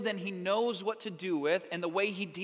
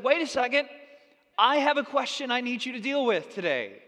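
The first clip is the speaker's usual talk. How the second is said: loud, raised voice cutting in; calling out; 4 semitones higher, 5 dB louder than usual. Pretend. Congregant interrupting the preacher mid-sermon, calling out. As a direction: interrupt boldly from the crowd, projecting.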